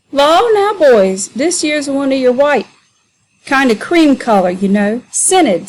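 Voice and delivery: high, mocking dialect voice, hamming it up; jeering Sambo. Sambo mocking the men to be sold, high and jeering.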